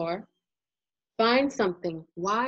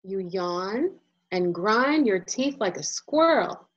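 plain reading voice

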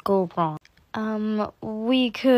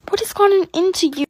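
ordinary talk

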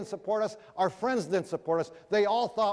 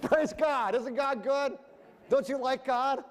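gleeful praise; 7 semitones higher, slower than usual. Retold or imitated. Gloating as the video fails.

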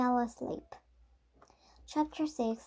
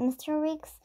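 usual speaking voice

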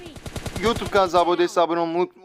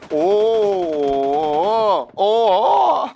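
excited shout; 3 semitones higher than usual. Real, not acted. Whooping at a wild moment in the match. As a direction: whoop then laugh.

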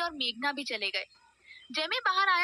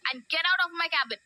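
angry shout; 3 semitones higher, much slower than usual. A narrator's reading. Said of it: "गुस्से से चिल्लाते हुए बोला".

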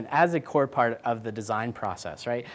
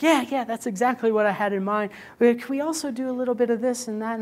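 higher, quick client voice; mock client; 9 semitones higher, faster than usual. Client approving v2 but asking for more, high quick mock voice. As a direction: rattle off one more request.

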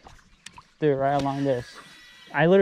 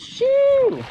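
startled shout; 5 dB louder than usual. Real, not acted. Startled by flipping fish.

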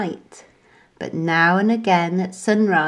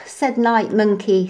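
ordinary delivery, nothing marked

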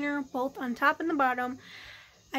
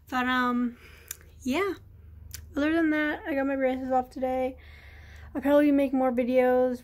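normal talking voice

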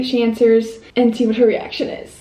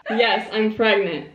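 ordinary talk, nothing marked

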